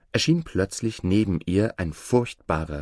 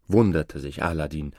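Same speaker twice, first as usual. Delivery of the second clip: usual speaking voice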